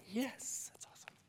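breathy excited whisper of delight; thrilled, barely voiced yes; gleeful whisper. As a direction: hush it out like a secret win. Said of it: "I can imagine Balaam going".

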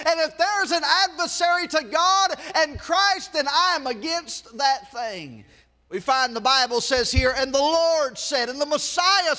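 preaching. Acted. Preacher expounding scripture.

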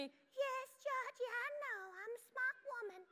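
high, soft mommy falsetto; put-on high, gentle mom; Mommy's voice. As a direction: coo it in a tiny, high mother voice. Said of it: "And she say to me".

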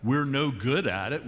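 ordinary delivery

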